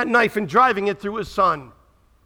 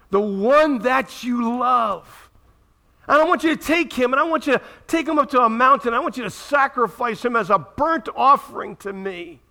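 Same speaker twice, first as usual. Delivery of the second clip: God commanding; 6 semitones higher than usual. Acted. Preacher voicing God ordering Abraham, booming.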